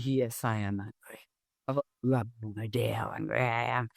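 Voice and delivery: low, held-down angry outburst; mock angry yell. Wife finally yelling at husband, low re-enacted anger. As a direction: act out pent-up fury, low and under the voice. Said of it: "I started yelling at him".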